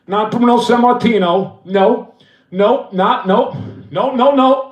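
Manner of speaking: ordinary talk, nothing marked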